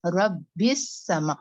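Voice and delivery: slow रब्बिस समा with a long hissing s; two s sounds clearly hissed; whistled double s. Shown as a demonstration. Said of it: "सीन की सफीर निकलेगी".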